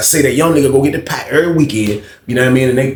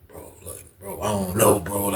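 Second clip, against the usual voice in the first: stunned hush; 6 semitones lower, 11 dB quieter than usual. Retold or imitated. Homeboy stunned after seeing picture.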